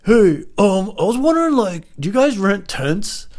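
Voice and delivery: high, loud mimicking voice; put-on stranger's voice. Stranger calling from next car about renting tents, high put-on voice.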